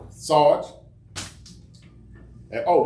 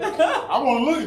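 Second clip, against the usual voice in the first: put-on pleading boy voice; 10 semitones higher, slower than usual. His own younger self pleading.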